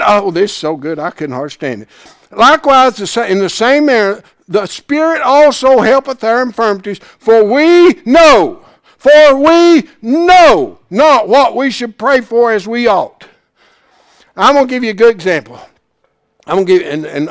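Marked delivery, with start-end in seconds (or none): 2.3-13.2 loud, slowed scripture proclamation; preached scripture reading. Real, not acted. Preacher reading Romans aloud with fervour, slow and loud. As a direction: proclaim the verse slowly.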